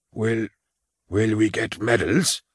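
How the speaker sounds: a touch of a quaver, hesitant and stumbling; nervous old soldier, faltering on the first word. Acted. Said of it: "in a quavering voice".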